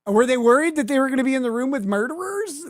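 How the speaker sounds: mocking rhetorical question; sarcastic. Real, not acted. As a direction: rattle it off in scorn. Sarcastic rant against S1.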